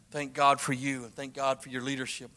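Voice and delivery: ordinary talk, nothing marked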